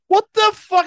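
high, loud mock outburst of shock; shocked patient. Waking up with the balls gone, a high loud mock yelp. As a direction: yelp it in disbelief. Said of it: "And you're like".

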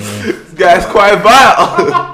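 slow, loud and high through laughter; helpless laughter stretching out the words; laughing. Real, not acted. Cracking up at foul joke.

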